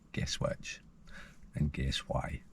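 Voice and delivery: conspiratorial whisper; teasing whisper. Real, not acted. Vlogger whispering a teasing quiz to his viewers. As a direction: whisper it as a knowing tease.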